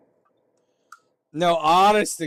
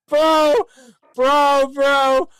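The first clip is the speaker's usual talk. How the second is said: loud, much higher disbelieving Bro; excited shouting; 10 semitones higher, 8 dB louder, much slower than usual. Real, not acted. Streamer freaking out at screen, loud excited shouts. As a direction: yell bro over and over in disbelief.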